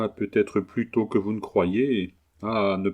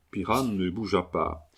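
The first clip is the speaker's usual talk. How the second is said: plain reading voice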